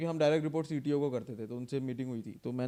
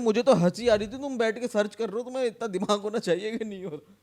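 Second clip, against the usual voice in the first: loud mocking boss voice; 6 semitones higher, 7 dB louder than usual. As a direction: boss quoted louder and higher, laughing at him. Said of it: "आप कह रहे".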